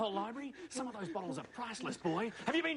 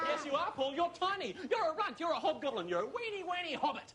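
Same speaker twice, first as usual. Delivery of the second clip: much higher, jeering insults; taunting; 9 semitones higher than usual. Acted. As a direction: belittle him with a high sneer. Taunting Paul about his height.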